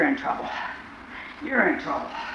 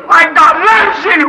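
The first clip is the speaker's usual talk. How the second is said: preaching; 10 semitones higher, 8 dB louder, slower than usual. Acted. Preacher climax line.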